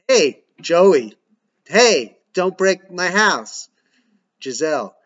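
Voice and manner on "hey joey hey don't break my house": loud, higher shout. Acted. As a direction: call out over the noise. Calling out over the fight.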